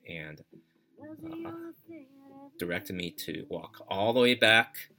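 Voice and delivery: ordinary delivery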